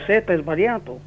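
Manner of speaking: ordinary talk, nothing marked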